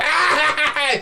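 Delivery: high, manic squealing laugh; hammed-up shriek of glee; mock girlish cackle. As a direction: imitate a little girl laughing her head off. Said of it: "giggling and laughing her head off".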